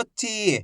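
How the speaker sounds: "ची" with a long, held ee; drawn-out vowel. Shown as a demonstration. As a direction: stretch out the vowel of "ची". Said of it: "खींच खींच के पढ़ेंगे".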